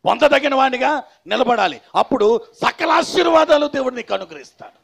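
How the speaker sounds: loud, high-pitched proclamation from the pulpit; forceful preaching. Real, not acted. Preacher tells believers God's call made them worthy, proclaiming forcefully. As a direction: drive each phrase home at full force.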